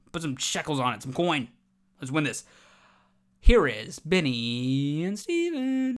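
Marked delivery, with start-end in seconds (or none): 3.4-6.0 slow, drawn-out show introduction; announcer intro. Pretend. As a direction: stretch the names like an announcer. Host announcing his co-hosts.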